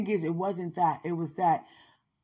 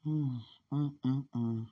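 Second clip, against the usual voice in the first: ordinary delivery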